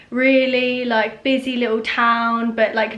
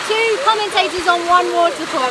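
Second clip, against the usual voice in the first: excited build-up; 6 semitones higher than usual. Real, not acted. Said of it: "both gonna commentate".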